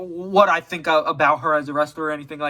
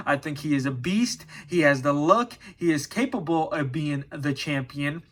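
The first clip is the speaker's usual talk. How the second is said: ordinary talk, nothing marked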